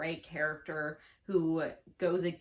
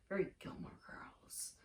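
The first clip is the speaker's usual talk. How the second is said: whispered aside; 11 dB quieter than usual. Real, not acted. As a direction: whisper it like a secret.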